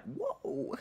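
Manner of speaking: high, surprised "whoa"; sudden startled realization; mock startled exclamation. Surprised pills numb his mouth.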